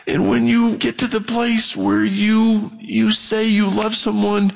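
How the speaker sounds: usual speaking voice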